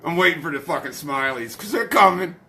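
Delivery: usual speaking voice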